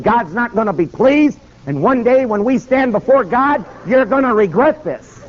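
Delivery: high, ringing preacher's warning; righteous warning. Retold or imitated. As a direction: warn of judgment, pitched high. Preacher rebukes the Attorney General.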